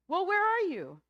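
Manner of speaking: high, loud searching question; acted frantic call. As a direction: shout up to someone unseen. Jack calling to unseen God.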